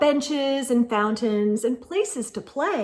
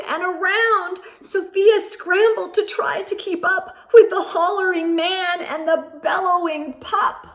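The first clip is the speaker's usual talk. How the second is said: higher, louder breathless telling of the chase; excited chase; 7 semitones higher, 5 dB louder than usual. A narrator's reading. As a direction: build the chase up, racing higher.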